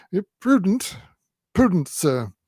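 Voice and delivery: put-on flattering old man praising him; lightly high old-man cry; old man's cry. A narrator's reading. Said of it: "cries Grandfather Smallweed".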